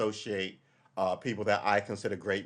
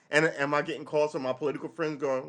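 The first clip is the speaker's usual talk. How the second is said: ordinary talk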